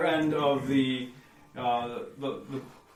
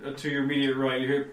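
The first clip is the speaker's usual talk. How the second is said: usual speaking voice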